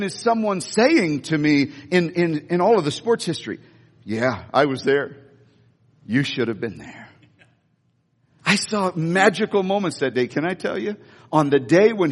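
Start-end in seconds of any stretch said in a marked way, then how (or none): 4.0-7.1 smug bystander. Retold or imitated. Imagined boastful bystander.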